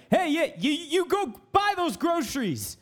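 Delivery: high-pitched mock coach giving orders; mock life coach. Mock life coach ordering a client to shop, hammed-up.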